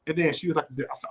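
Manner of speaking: ordinary delivery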